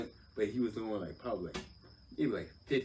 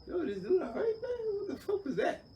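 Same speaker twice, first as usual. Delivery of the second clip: inner voice. Retold or imitated. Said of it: "the first thing I was wondering was like".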